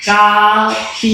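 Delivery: very slow, stretched copy kept inside the mouth; drawn-out mouthed word. Pretend. Husband mouths coffee to his wife, stretched and slow. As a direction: stretch copy out slowly as if shouting with no voice. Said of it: "ஏன்டா வாய்க்குள்ளேயே பேசுற?".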